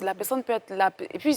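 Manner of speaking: normal talking voice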